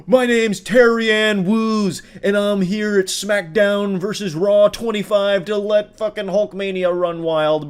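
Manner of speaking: slow, high, loud wrestling promo voice; wrestling entrance. Retold or imitated. Commentator mocking the woman as a wrestling announcer. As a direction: announce yourself like a WWE entrance. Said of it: "announces herself like it's a W W E entrance".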